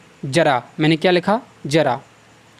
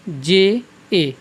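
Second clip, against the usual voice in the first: के एच ए spelled letter by letter, very slowly; के एच ए dictated letter by letter; much slower than usual. Shown as a demonstration. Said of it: "इसका क्या होता है?".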